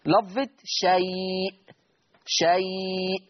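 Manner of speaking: "شيء" with the ay glide held long; lengthened leen. Shown as a demonstration. Teacher demonstrating madd al-leen, "شيء" drawn out. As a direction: stretch "شيء" slowly through shayyy. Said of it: "مد اللين المهموز".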